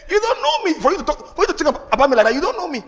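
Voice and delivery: high-pitched laughing protest. Retold or imitated. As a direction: laugh through a mock-incredulous rebuttal. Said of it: "And I laugh".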